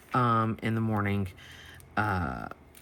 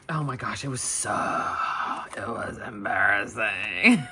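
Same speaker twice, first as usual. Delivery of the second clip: embarrassed laughter. Real, not acted. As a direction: stretch it out and crack up.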